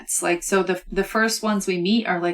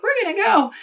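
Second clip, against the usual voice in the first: high-pitched mock voice of little baby oysters; 13 semitones higher, 3 dB louder than usual. Retelling how baby oysters ignored their mom, high mock baby voice. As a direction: pipe up high, imitating eager babies. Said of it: "And the little babies are like".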